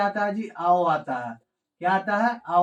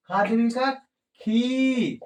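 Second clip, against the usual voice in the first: ordinary delivery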